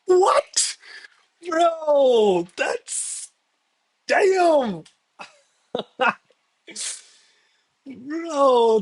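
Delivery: high-pitched excited disbelief. Real, not acted. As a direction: squeal with delight at the upset. Reacting to Norway beating Brazil.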